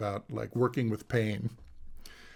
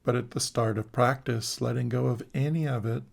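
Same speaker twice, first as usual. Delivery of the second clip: guided meditation. Acted. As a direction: guide them into the body, calmly and unhurried.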